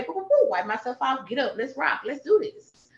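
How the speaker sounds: high, bright self-pep talk, brushing off tears; pep talk to herself. Retold or imitated. Woman cheering herself up after crying, upbeat and high. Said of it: "And then I'ma say".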